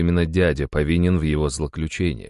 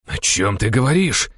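higher, menacing demand; growled question; 6 semitones higher than usual. A narrator's reading. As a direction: growl it out, rising at the end. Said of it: "прорычал Денис". (прорычать с угрозой)

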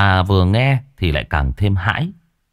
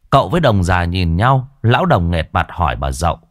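usual speaking voice

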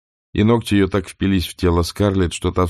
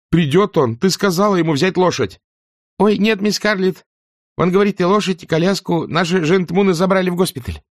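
urgent demand; 12 semitones higher than usual. Acted. Scarlett then Prissy.

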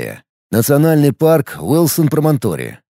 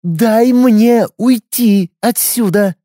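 put-on crazy girl voice; 9 semitones higher, 4 dB louder, much slower than usual. Trapped crazy girl begging.

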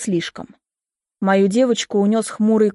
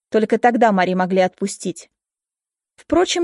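ordinary delivery

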